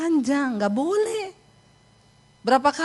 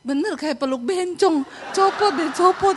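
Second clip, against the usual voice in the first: higher, urgent mock complaint; repelled husband; 6 semitones higher than usual. Husband demanding wife remove extensions, high mock alarm. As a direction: insist in a high, appalled voice.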